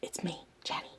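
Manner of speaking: whispering so nobody wakes; hushed late-night whisper; quiet at night. Real, not acted. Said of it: "I'm gonna talk quiet because".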